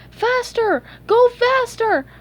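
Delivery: high, panicked yelp. A narrator's reading. Two friends fleeing a monster in a dream, yelping. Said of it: "They yelped".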